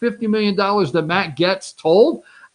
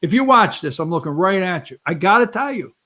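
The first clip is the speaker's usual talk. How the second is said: ordinary delivery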